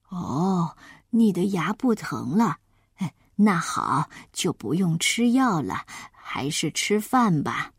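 low, slowish granny voice; placid grandma. Acted. Grandma tells the child to eat instead, low and placid.

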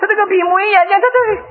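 high-pitched fumbling for an answer; flustered stammer. Acted. Father stuck on son's question, flustered stammer. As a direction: stammer in mock panic, pitch jumping up.

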